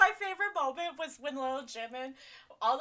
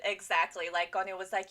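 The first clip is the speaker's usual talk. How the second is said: normal talking voice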